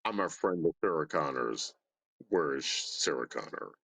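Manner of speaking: deep, low robotic monotone; Terminator impression. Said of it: "here's my Terminator".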